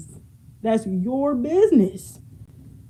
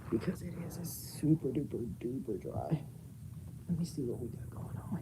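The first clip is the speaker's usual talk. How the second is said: whispered, much softer, throat too dry; 6 semitones lower, 15 dB quieter than usual. Real, not acted. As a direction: barely whisper it, like your throat is parched. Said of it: "My throat is obnoxiously dry".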